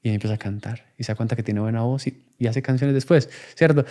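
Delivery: usual speaking voice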